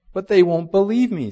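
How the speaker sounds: high, loud storybook objection; worried protest. A narrator's reading. Lucy objects to Aslan's instruction, in worried protest. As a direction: read it as a child's pleading protest.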